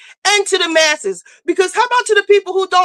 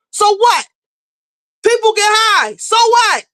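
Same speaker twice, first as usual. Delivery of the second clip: scolding Nene with an impatient, raised so what; exasperated so what; 6 semitones higher than usual. Real, not acted. Scolding Nene, dismissing the drug rumours.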